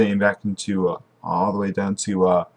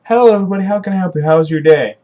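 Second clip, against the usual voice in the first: bright, high welcome to customers at a restaurant; a hint of a bubbly diner server; cheerful server greeting; 9 semitones higher, 5 dB louder, faster than usual. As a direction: sing it out like a friendly waitress. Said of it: "overheard the sweater asking".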